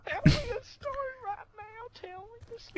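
high, whiny fake-crying kid; hammed-up crying child begging for a story; mock crying child. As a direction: whine for a bedtime story. Childish demand, crying sound.

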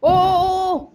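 sudden high loud oh oh oh; startled burst of excitement; excited exclamation. Real, not acted. Sudden gunfire in game.

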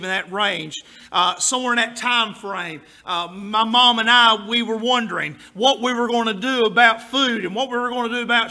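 ordinary delivery

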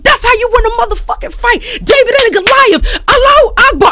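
high, loud victorious scream. Retold or imitated. Wife ringside screaming for her boxer husband's knockout. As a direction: scream it out like your man just won. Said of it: "I'm just screaming out all of this stuff".

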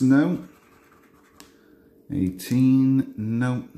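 ordinary talk

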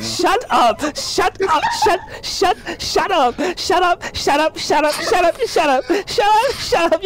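high, repeated, embarrassed protest; embarrassed squeals of shut up; flustered shut up. Real, not acted. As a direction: keep yelping it, half laughing.

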